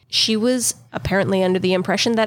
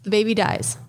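ordinary talk

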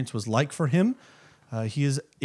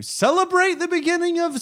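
high singsong. Acted. Sudden high sung-out phrase.

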